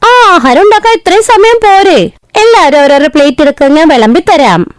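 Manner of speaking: high, airy bride's voice; breezy bride. Acted. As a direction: brush off the praise lightly. Rani boasting at table.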